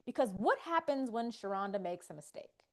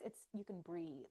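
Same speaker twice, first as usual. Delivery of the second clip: usual speaking voice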